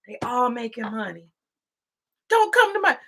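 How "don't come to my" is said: higher and louder, a hammed-up outraged governor; mock politician. Mocking politicians' theater, a hammed-up outraged protest. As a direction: play the grandstanding politician, mocking. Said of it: "That's for theater".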